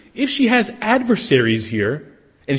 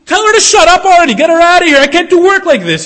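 high, very loud exasperated yell; furious shout; 14 semitones higher, 11 dB louder than usual. Acted. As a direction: blow up at the servants.

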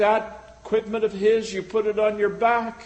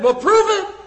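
much higher and louder, a sudden dare; preacher daring his congregation; sharp challenge; 10 semitones higher, 7 dB louder than usual. Real, not acted. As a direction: throw down the gauntlet.

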